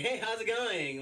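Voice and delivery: high, slow fake-cheery greeting; fake cheerful. Worker greets customers with a forced cheerful voice. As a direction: put on a customer-service smile. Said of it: "I'm like".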